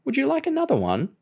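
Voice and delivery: put-on high, sweet old lady. A narrator's reading. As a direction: offer it like a doting granny. Old neighbour lady offering.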